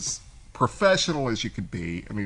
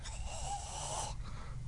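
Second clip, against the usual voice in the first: long, much higher breathy sigh of despair; exasperated sigh; 16 dB quieter than usual. Retold or imitated. Hearing his rival introduce himself, a heavy sigh. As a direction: let all the air out in defeat. Hearing the new rep call.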